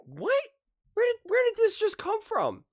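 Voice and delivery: shrieking high in disbelief; real incredulity, pitched far up; incredulous what. Real, not acted. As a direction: voice jumps way up, baffled. Baffled by analyst's reversal.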